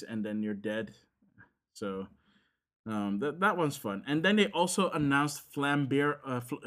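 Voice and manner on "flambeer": American-accented Flambeer, "flam" with a flat A as in "lamb"; American pronunciation. Real, not acted. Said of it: "saying it in an American way".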